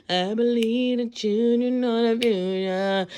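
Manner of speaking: slow, empty sing-song parroting of the lyric; mock someone reciting without thinking; mindless recitation. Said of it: "Don't just".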